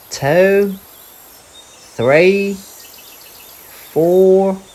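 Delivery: high, loud wombat voice; Little Wombat counting. Acted. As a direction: start counting like a small child. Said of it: "counting flowers".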